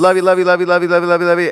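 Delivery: high, loud run-on mimicry; rattled-off repetition. Mimics constant family love-yous.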